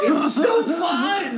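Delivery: high, loud, drawn-out enthusiasm; gleeful insistence. Real, not acted. Friend insisting movie is fun to protesting mom, gleeful shout. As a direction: shout it slowly and gleefully over the objection.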